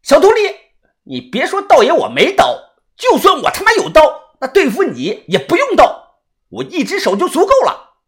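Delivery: high, heated insults; furious high-pitched bravado; angry cursing. A narrator's reading. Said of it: "小道士红着眼睛大骂".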